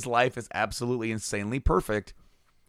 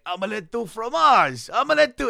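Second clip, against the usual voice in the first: high, loud cartoon-boy cry; excited high-pitched imitation; mock cartoon exclamation; 12 semitones higher, 3 dB louder than usual. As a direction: imitate a cartoon character blurting it, pitch leaping up. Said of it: "he says it with different inflections".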